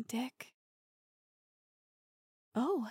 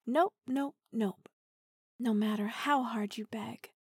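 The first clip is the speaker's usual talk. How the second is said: ordinary talk, nothing marked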